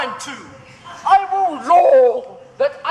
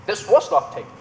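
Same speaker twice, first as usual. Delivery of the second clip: normal talking voice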